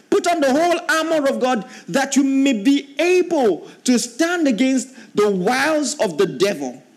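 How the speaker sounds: preacher proclaiming the armor verse; fervent preaching. Acted. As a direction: sound the verse out boldly. Preaching scripture.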